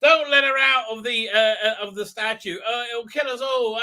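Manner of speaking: lightly hammed-up East London warning; mock East London accent. Son warning it will kill them, mock East London accent. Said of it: "in his East London accent".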